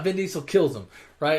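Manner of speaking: ordinary delivery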